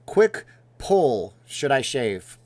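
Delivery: bright shouted announcement; loud call-out. Pretend. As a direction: call it out like a host.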